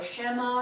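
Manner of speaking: Shema said as SHEM-ma; short e in the first syllable of Shema; wrong pronunciation. Shown as a demonstration. Teacher showing students another mistake, Shema as SHEM-ma. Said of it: "should not be actually pronounced".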